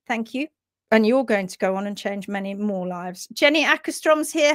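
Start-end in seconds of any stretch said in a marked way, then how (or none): none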